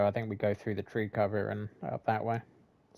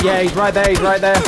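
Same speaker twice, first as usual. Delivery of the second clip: loud, higher urgent callout; urgent shout; 6 semitones higher, 12 dB louder than usual. Real, not acted. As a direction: yell the enemy's position. Enemy spotted mid firefight.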